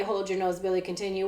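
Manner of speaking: plain reading voice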